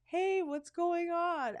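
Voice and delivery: high, sweet coaxing voice, slowing at the end; friendly guy to a warthog. Retold or imitated. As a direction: coax the warthog like a pet. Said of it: "he's like".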